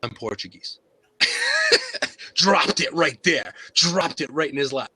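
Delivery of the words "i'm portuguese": much softer, lower reveal; hushed, understated retold admission; deadpan confession. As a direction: drop it quietly as a punchline. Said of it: "And I said".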